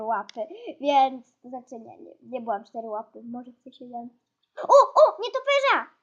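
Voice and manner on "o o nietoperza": high, loud startled call; excited alarm. Real, not acted. Gamer suddenly spots a bat, excited alarm. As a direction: call out the sighting with a jump in pitch.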